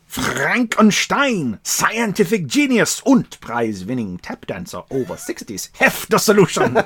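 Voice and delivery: a hammed-up German mad scientist accent. Podcaster reading a comic's mad scientist in German accent.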